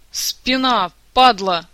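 loud, pained cursing; yelp, then curse loudly; howl of pain. A narrator's reading. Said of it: "взвыл Кантор".

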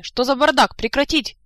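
very loud, high-pitched order; barking colonel. A narrator's reading. Colonel breaking up a brawl from the doorway, barking orders. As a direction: shout the room to attention. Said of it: "с порога рявкнул полковник Сур".

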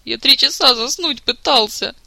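slow, loud, indignant; aggrieved complaint. Acted. Jacques, sobered against his will, loudly grieving lost sleep. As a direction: protest bitterly at being sobered.

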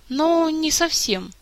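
high, slow, hesitant; bashful. A narrator's reading. Prince answering about his mentor's strict rules, bashful and hesitant. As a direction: draw it out shyly. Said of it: "застеснялся Мафей".

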